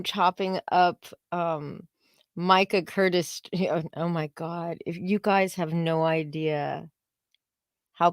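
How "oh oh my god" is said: ordinary delivery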